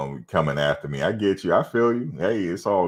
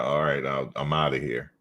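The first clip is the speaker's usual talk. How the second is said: ordinary talk, nothing marked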